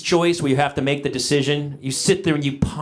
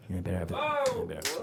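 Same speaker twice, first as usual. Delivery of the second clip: muttered aside; 11 semitones lower, 13 dB quieter, much faster than usual. Real, not acted.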